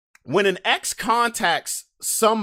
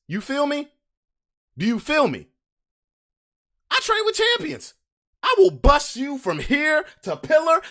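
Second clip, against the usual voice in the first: hyped boast; 8 semitones higher than usual. Real, not acted. Bragging to the chat about training, hyped. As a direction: pitch jumps higher, pumped up.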